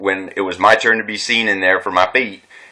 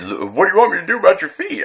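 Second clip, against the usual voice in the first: smug; 10 semitones higher, faster than usual. Retold or imitated. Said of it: "talking down to me, smug type".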